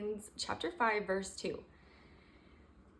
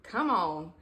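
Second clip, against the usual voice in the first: ordinary talk, nothing marked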